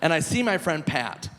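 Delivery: ordinary talk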